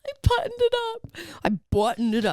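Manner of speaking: laughing. Real, not acted. Defending buttoning flannel.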